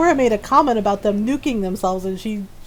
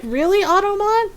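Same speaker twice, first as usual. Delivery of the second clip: high-pitched incredulous challenge; playful, squeaky disbelief; mock disbelief; 10 semitones higher than usual. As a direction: question it high, as if scandalized. Acted challenge to Automod.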